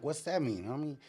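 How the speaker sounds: usual speaking voice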